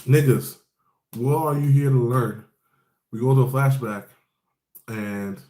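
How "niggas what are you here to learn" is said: louder mock stern demand; raised voice, quoting the mentor; Garp's challenge. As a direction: bark it like a tough old instructor. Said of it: "And Garp is like".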